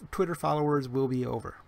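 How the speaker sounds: ordinary delivery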